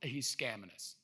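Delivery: low, very soft mutter; skeptical aside. Acted. Skeptic muttering about his leader's claim, skeptical aside. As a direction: mutter the accusation under your breath. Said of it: "And Mark is like".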